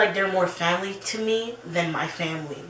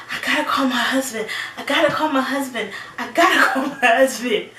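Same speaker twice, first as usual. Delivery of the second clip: higher, louder, fast frantic panic; panicked repeat; 7 semitones higher, 6 dB louder, faster than usual. Retold or imitated. Frantic panic, calling for her husband after the crash. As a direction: replay the panic, rushing. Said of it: "I'm like".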